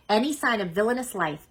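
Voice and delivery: ordinary delivery, nothing marked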